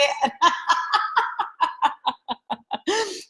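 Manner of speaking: long giggling laugh. Real, not acted. Host laughs at Becky's misreading of the notification, amused. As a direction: crack up helplessly at a funny comment.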